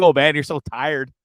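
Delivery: usual speaking voice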